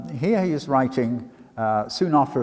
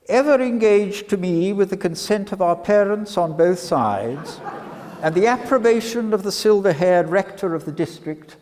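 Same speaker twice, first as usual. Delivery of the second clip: high, raised, theatrical romantic suitor; mock lover's speech; 8 semitones higher, 5 dB louder than usual. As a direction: ham up the lovestruck suitor, much higher. Hammed-up reading of suitor's speech.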